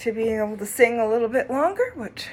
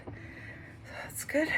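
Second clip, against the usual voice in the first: breathy whisper, slow and unvoiced; 5 semitones higher, 15 dB quieter, much slower than usual. Real, not acted. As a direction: whisper it to yourself, slowly. Whispered aside after oxygen check.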